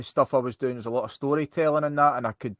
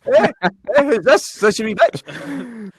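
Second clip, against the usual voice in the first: mock customer retort; 9 semitones higher, 5 dB louder than usual. Imitating a customer's comeback.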